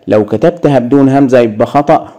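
usual speaking voice

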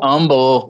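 Humble as "UM-bul", drawn out; dropped H. Shown as a demonstration. Friend echoing the parents' word, Humble without the H. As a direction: echo "Humble" with no H. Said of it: "without the H".

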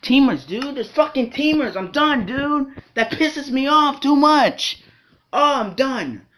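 real exasperated outburst; gamer rage. Real, not acted. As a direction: rant loudly, fed up with cheap tactics. Rage after being killed by teamers.

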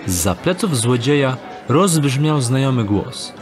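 normal talking voice

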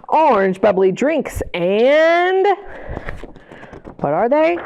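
usual speaking voice

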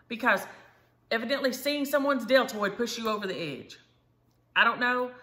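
loud, high indignant rant; loud fed-up rant about sleeves; exasperated rant. Real, not acted. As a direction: vent loudly at the absurdity.

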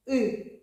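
a loud, isolated И vowel; «И» sounded alone as a vowel; sounding out. Shown as a demonstration. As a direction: say the vowel «И» on its own, clearly. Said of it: "І дивимось".

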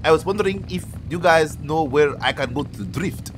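badly faked Italian-Nigerian accent; made-up foreign accent. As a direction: put on a terrible mixed foreign accent. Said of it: "I don't know what kind of accent that is".

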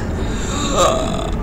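startled gasp. Real, not acted. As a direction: gasp in sudden alarm. Startled while reading game text.

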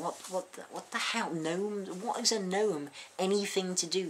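what the hell, thrown up high; baffled. Real, not acted. Vlogger baffled reading a troll comment, incredulous. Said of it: "baffled by troll comment".

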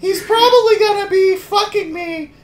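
put-on sing-song little girl diary voice. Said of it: "Read it in a little girl voice".

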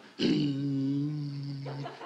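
low, steady vocal car hum; engine drone. Acted. Comic telling of Lulu driving from the party, engine drone. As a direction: imitate a car droning along. Car drive mimicked in routine.

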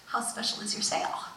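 half-whispered, leaning in to ask; hushed curious shopper; hushed question. Retold or imitated. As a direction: ask quietly, almost secretly. Asking shopkeeper, hushed.

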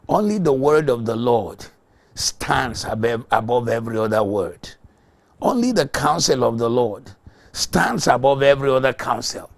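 loud fiery sermon proclamation; forceful pulpit preaching; preaching at full force. Real, not acted. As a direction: thunder the scripture to the congregation.

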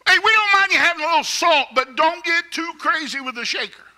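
breezy condescending warning, hammed-up; mock unbelieving world. Preacher voicing the unbelieving world, fast and high in mock tones. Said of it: "what the unbelieving world says about us".

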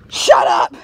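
loud, harsh outburst; explosive mock-angry shout; sudden shout. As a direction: yell it to silence someone.

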